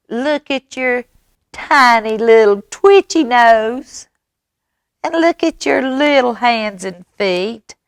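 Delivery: high-pitched, scornful mouse voice; mocking mouse. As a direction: tease in a high, squeaky little voice. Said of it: "Mouse goes".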